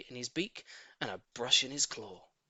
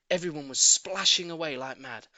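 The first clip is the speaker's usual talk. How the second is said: usual speaking voice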